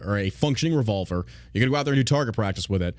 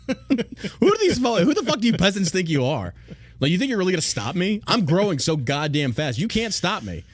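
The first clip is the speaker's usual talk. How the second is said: higher, sneering troll voice; mock arrogant troll; 7 semitones higher than usual. Streamer plays the smug troll who says he can't be stopped, higher mock voice.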